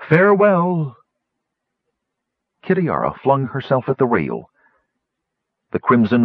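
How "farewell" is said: high, carrying farewell call; dragon's farewell. A narrator's reading. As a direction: call goodbye from far away as the falling dragon. Falling dragon calls goodbye.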